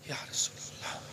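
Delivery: hushed, reverent whisper. Acted. Companion addressing the Prophet with adab, whispered. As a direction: breathe it with deep respect.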